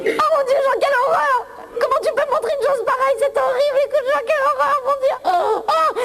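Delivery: high hammed-up shock with laughter; horrified. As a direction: recoil in comic horror. Horrified reaction to Jean undressing.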